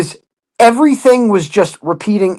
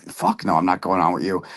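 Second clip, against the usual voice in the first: muttered aside; 11 semitones lower, 8 dB quieter than usual. Retold or imitated. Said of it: "I'm like".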